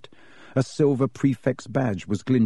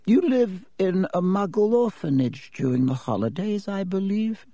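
put-on high old man's voice, asking with interest; curious old headmaster; 8 semitones higher than usual. Dippet asking Riddle about the orphanage, curious high voice. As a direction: lean in with a questioning lilt. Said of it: "said Dippet curiously".

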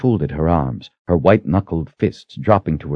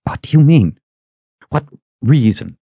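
high, halting, anxious questions; frightened questioning; dry-throated unease; 7 semitones higher than usual. A narrator's reading. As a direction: force the words out, higher. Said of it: "Kaelin's throat was so dry".

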